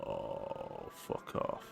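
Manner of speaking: real low, unvoiced mutter under the breath; breathy mutter. Real, not acted. Streamer reacting to a creepy horror game video, muttered under the breath. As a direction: swear softly, almost whispered.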